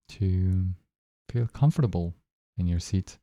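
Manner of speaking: calm, even guiding voice with long pauses; unhurried phrases, spaced out with long silences; guided meditation. Acted. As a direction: lead listeners gently into noticing their breath.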